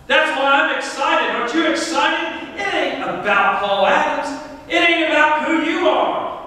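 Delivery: high-pitched, exultant sermon delivery; excited preaching. Real, not acted. Pastor telling congregation that God lives in them, excited preaching. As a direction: proclaim it to the whole church, pitched high.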